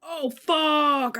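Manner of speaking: alarmed curse. Real, not acted. Panic at unchecked setting.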